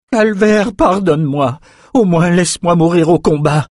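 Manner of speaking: high, loud begging voice; desperate plea. A narrator's reading. Warrior at the king's feet begging to die in combat, desperate. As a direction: plead for mercy, pitched well up. Condemned man begs the king.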